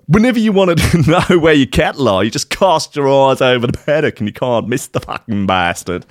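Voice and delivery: broad, badly faked Aussie accent; terrible Australian accent. Podcast host voicing a cattle owner, terrible Australian accent. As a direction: start high in an exaggerated Australian accent. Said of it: "That's a terrible Australian accent".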